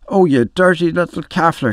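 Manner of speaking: read-aloud sisterly outrage; scolding. A narrator's reading. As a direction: scold a naughty little brother, indignant. Nora scolds the child.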